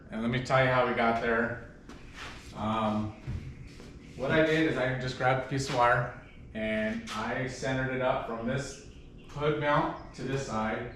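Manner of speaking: ordinary delivery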